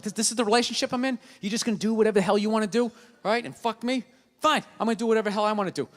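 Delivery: fast petulant rant; childish rant. Retold or imitated. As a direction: rattle it off like a sulking kid.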